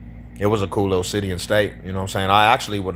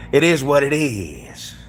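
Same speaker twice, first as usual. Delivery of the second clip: drawn-out repeat; 7 semitones higher, 3 dB louder, slower than usual. Shown as a demonstration.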